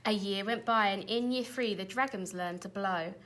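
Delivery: ordinary delivery, nothing marked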